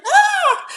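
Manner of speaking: high panicked scream; child's scream. Retold or imitated. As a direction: scream in sudden fright. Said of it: "my son's voice going".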